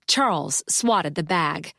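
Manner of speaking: ordinary delivery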